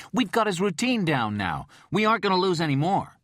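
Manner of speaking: put-on young detective cutting into the argument; eager protest. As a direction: jump in with urgency. Young cop leaping in.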